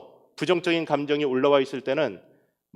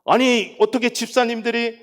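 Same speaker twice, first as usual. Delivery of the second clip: loud, higher acted reprimand; raised voice, imitated outrage; indignant scolding; 6 semitones higher, 5 dB louder than usual. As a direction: re-enact telling people off. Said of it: "막 야단도 치고 막 그랬어요".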